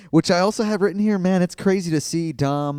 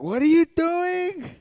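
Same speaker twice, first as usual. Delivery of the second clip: Eddie impression; 14 semitones higher, slower than usual. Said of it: "and Eddie is like".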